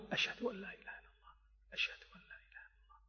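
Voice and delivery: whispered under the breath, fast; low voice. Shown as a demonstration. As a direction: breathe the shahada in a hushed whisper. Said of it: "أن يقول الشهادة بصوت منخفت أو خافت". (همس خافت سريع)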